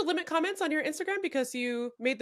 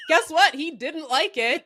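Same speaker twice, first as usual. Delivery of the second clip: cracking up, higher and louder; laughing; 6 semitones higher, 8 dB louder, slower than usual. Real, not acted. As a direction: say it through helpless laughter. Both hosts cracking up at joke.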